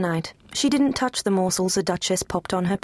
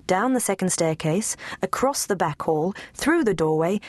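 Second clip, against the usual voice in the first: usual speaking voice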